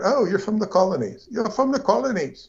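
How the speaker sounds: high, mocking imitation of a British accent. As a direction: send up a snooty Brit in a British accent. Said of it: "talking to a Brit".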